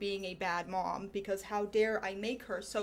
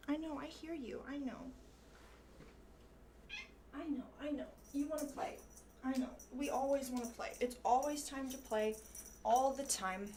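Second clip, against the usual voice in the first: talking to the cat; 2 semitones higher, 7 dB quieter than usual. Real, not acted. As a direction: coo to the cat softly. Soothing the meowing cat.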